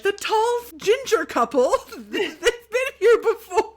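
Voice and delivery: laughing impression. Conductor gossiping about them.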